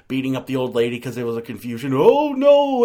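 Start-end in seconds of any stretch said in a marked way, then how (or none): 1.9-2.8 much higher, louder mock alarm; hammed-up sitcom cry of dismay; loud, high, over-the-top mock shock; sitcom gasp. Mimicking a sitcom reaction.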